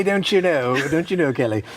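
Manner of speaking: teasing co-host in mock English accent. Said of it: "This makes us want to talk in very English accents".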